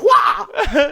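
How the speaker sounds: high mock martial-arts cry; sudden high "wah" noise in play; imitated action yelp. Co-host mimics the ninja brushing off chat, high mock yelp. Mimed move after mock dismissal.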